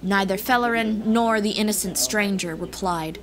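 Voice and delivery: plain reading voice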